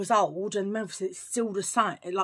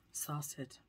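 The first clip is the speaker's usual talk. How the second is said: ordinary talk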